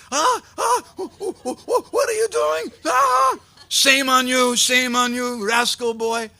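very high, spluttering, stammering outcry; gasp, stammer, then shout in scandalized outrage; outraged calling out. A narrator's reading. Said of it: "He started calling out".

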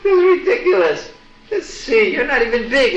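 high, laughing taunt; a child god laughing at a shrinking demon; amused laughter. Acted. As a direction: tease him through laughter. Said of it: "Krishna just laughed".

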